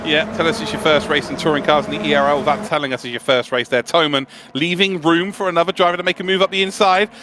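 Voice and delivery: ordinary delivery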